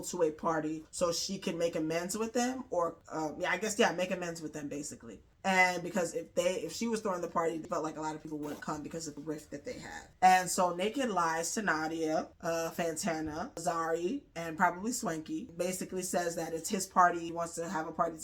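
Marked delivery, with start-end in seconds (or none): none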